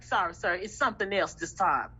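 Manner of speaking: a quietly sorry official turning the caller down; apologetic. Retold or imitated. Fema worker telling a caller the rules changed again, low and apologetic. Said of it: "I'm like".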